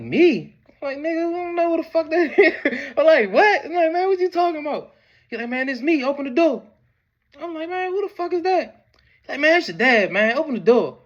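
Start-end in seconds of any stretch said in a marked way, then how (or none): 0.0-4.9 high, loud disbelief; can't believe who's there; incredulous. Retold or imitated. Son confused by the voice at the door. 5.5-11.0 lightly voiced father, higher and louder; father at the door. Father outside asking his son to open the door.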